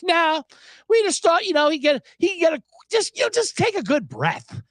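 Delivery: half-playful imitation of a coach explaining himself; Ron Rivera impression. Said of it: "Ron Rivera had the balls to say".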